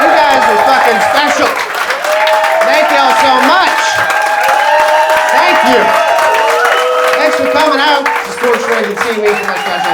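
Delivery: loud, high stage thanks; shouting to crowd. Acted. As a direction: call out over the cheering.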